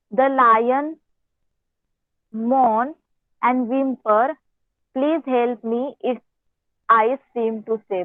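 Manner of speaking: reading the story aloud very slowly, word by word. Acted. Teacher reads the English story to her class in a slow reading voice. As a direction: read each phrase out slowly so the pupils can follow.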